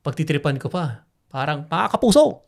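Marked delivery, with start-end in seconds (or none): 1.7-2.5 a light take on the veteran newscaster's greeting, pitched higher; lightly voiced news anchor hailing his viewers; Mike Enriquez impression. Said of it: "impression, di ba".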